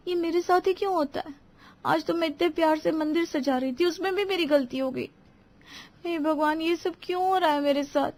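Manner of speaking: high, soft, hurt voice; tearful. Acted. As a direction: lament alone, holding back tears. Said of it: "उसकी आंखों में आंसू थे". (रुआँसी, दबी आवाज़)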